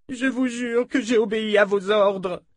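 high, tearful pleading; en sanglots. A narrator's reading. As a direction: swear it through acted sobs. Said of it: "Landérienne éclata en sanglots". (voix aiguë, suppliante et éplorée)

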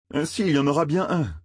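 ordinary delivery

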